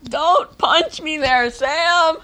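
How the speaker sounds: high, drawn-out indignant cry; hurt, high-pitched complaint; pained protest. Real, not acted. Protesting after being punched.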